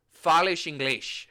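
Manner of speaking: "Fales inglês" slowly, each final s said as sh; shushed S sounds. Shown as a demonstration. Traveler demonstrating European Portuguese, "Fales inglês" with sh endings. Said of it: "there's a shush on the both".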